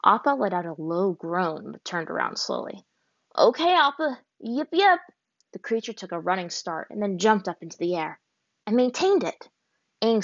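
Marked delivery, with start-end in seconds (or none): 3.4-5.0 much higher, louder call to fly; eager command. A narrator's reading. Aang to Appa, high and excited. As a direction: cheer the bison into the air.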